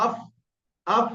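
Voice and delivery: "আফ" with air escaping on f; airy fa. Shown as a demonstration. Teacher shows fa, "আফ" with a puff of air. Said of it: "একটু হাওয়াও বেরিয়ে যাবে".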